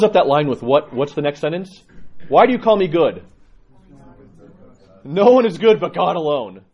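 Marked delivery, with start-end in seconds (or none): 2.3-3.2 louder repeat. Acted. 5.0-6.6 emphatic quote. Acted. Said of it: "quoting Christ".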